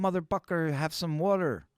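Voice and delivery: high-pitched imitation of a Filipino accent. Filipino girl offering him water, mimicked Filipino accent. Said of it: "That's how she would talk".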